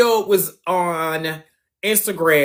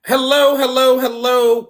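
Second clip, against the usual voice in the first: bright, raised livestream hello; upbeat greeting; 6 semitones higher, 4 dB louder than usual. Real, not acted. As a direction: greet the audience with energy. Host greets live stream audience.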